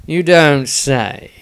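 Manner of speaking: slow, drawn-out sarcastic jab; dripping sarcasm. Acted. Dieter mocking the wizard's obvious guess, slow sarcasm. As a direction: let the sarcasm drip like venom. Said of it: "sarcasm dripping venomously".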